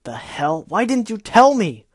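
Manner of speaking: very loud, high, outraged yell; furious shout. Acted. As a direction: scream it at her, betrayed. Gilda furious at being kept out.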